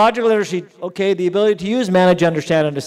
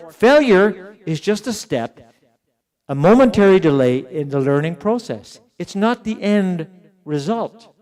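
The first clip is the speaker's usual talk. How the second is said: higher and louder, slowed right down; slow emphatic point; 4 semitones higher, 4 dB louder than usual. Shown as a demonstration. Lecturer to teachers, slowly stressing failure is a step. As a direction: land the lesson slowly. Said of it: "key lesson stated".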